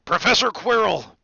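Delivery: high, loud hearty hail; Hagrid. A narrator's reading. Hagrid spotting the professor, loud hail. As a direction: call out to an acquaintance.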